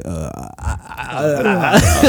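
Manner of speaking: mock tongue-tied stammer; flustered freeze-up. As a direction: choke on the word, badly faked panic. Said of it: "what if you say some dumb shit like".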